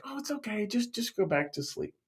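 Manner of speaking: very soft, high, gentle reassurance; tender, hushed-down bedtime voice; soothing Eli. Acted. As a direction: hush a child back to sleep. Eli soothing the boy to sleep.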